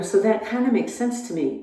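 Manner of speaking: ordinary talk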